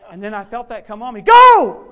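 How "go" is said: sudden high, full-force shout; screamed command. Retold or imitated. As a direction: scream the order at her. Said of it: "then I scream at her".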